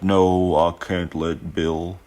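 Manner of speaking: put-on lightly drawn-out southern accent for Biden; slow, a hint of a southern Biden; half-hearted southern Biden impression. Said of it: "I gave Biden a southern accent".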